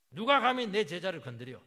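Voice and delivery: loud roar, 누가 punched hardest; furious shout. Acted. God shouting down those who touch his disciples, roaring. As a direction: hurl 누가 at them like a challenge. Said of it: "막 소리부터 치고 봐요".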